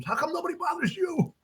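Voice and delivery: high-pitched mock complaint; aggrieved boy. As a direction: whine at a sister in an imitated boy's voice, way up high. Bullied boy confronts his sister.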